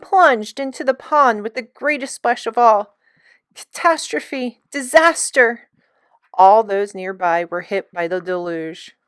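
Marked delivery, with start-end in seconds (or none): 3.5-5.7 dramatic exclamation. A narrator's reading. Narrator exclaims the disaster.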